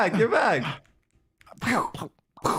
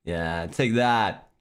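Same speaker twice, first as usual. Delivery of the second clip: slow taunt; 9 semitones lower, much slower than usual. Pretend. Mock hero taunt in game.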